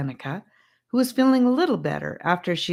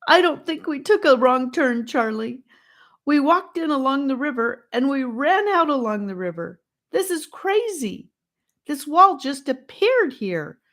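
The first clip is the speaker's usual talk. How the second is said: high, loud, panicked pleading; near hysterical sobbing; 10 semitones higher, 5 dB louder than usual. A narrator's reading. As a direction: voice climbing toward tears, trapped and frightened.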